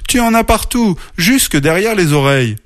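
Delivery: high-pitched motherly exclamation; fuss, starting high and easing down; dismayed fussing. A narrator's reading. Mother bear brushing mud off her cub, dismayed.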